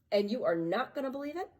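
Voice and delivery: ordinary delivery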